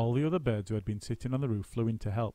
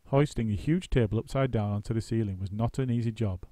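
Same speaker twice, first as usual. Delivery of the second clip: plain reading voice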